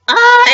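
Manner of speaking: loud, high exasperated "ah"; frustrated cry. Real, not acted. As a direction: burst out in frustrated longing. Exasperated at not having the pet.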